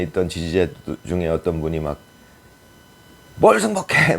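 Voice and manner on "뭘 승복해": 높게 치솟는 항의조 외침; 항의하는 지지자처럼 높은 음으로 외치기; 지지자의 고함 흉내. Retold or imitated. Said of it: "막 이렇게 소리치고 그랬죠". (소리치는 지지자 흉내)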